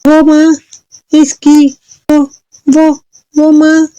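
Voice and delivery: high, halting, flustered daughter; nervous stammering. A narrator's reading. Daughter hiding news from mother, nervous stammer. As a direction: stall and falter in a high voice. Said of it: "चुलबुल घबरा के बोली".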